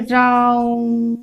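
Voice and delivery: slow, with the vowel in राम pulled out long; drawn-out Ram. Shown as a demonstration. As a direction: hold the आ of राम long and even. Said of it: "चिन्हित किया हुआ रहता है". (राम का आ धीरे-धीरे लंबा खींचकर)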